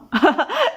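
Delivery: high burst of laughter; laughing. Acted. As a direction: burst out laughing at your friend. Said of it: "الضحكة سمعت في البيت كله".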